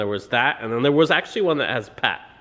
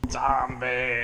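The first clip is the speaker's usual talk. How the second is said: zombie. Pretend. Dad playing zombie with child.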